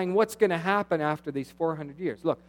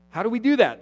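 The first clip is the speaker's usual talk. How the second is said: louder, higher offended question; mock-indignant priests asking back; 7 semitones higher, 9 dB louder, faster than usual. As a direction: protest as the priests. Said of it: "the priest said".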